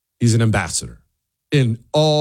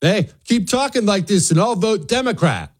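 high put-on threatening voice; mock threat; 9 semitones higher than usual. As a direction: wag a finger with a mocking warning. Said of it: "that whole threatening thing".

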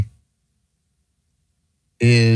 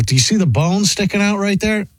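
ordinary talk, nothing marked

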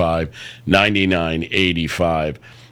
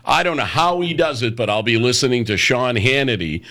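ordinary delivery

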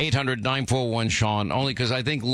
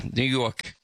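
"York" in a put-on New York accent; imitated New York accent. Host corrects his producer, imitated New York accent.

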